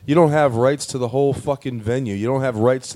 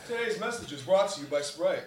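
softly voiced sponsor announcement, higher; mock ad read; 4 semitones higher, 11 dB quieter than usual. Friend fakes a Sprite sponsor read for the podcast, mock ad voice. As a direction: play a radio ad host reading the sponsor line.

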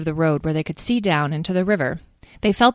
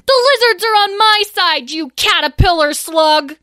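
angry retort; 12 semitones higher, 6 dB louder than usual. A narrator's reading. Sibling fight, Edwin retorting.

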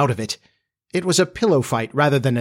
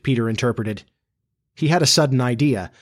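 ordinary delivery

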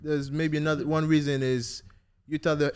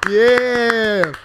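loud, high ring-announcer call; hype announcer shout; 7 semitones higher, 11 dB louder, slower than usual. Real, not acted. Host introducing the Madrista Kenny, rowdy announcer shout. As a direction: build to a long, soaring yell on the name.

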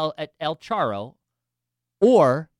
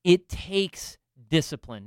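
normal talking voice

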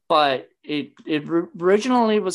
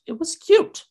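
very high, louder, rising defence; insistent mock reply; 6 dB louder than usual. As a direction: squeak out a playful protest. Acts out own defensive reply.

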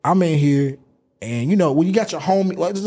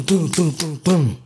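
normal talking voice

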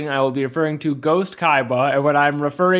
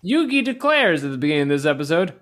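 ordinary talk

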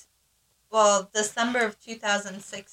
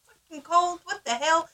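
much higher, exaggerated disbelief; mock shock; 9 semitones higher than usual. Mom shocked at the cold while driving daughter, exaggerated. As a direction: gasp at the cold like it's absurd.

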